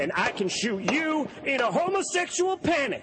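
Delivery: high panicked sketch yell; panicked shout. Acted. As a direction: squeal it out in a panic. Sketch actor shouts punchline.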